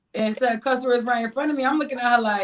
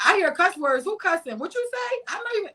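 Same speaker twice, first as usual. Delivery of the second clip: strict mom; 9 semitones higher than usual. Retold or imitated. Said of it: "my mother be like".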